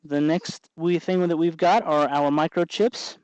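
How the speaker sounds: ordinary talk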